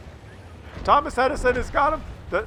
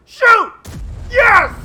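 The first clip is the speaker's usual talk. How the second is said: very high, much louder yell; excited shout; 11 semitones higher, 11 dB louder than usual. Real, not acted. Commentator yelling at the screen as the shot fires. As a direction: scream the command then triumph.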